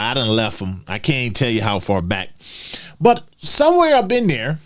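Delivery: normal talking voice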